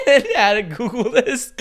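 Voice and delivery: loud, high, laughing through the words; cracking up mid-sentence. Real, not acted. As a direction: barely get it out for laughing. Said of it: "I'm laughing about this".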